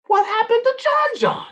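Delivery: very high, alarmed cry of a mother; a mother seeing her child transformed; shocked mama. Acted. Mother sees son turned candy.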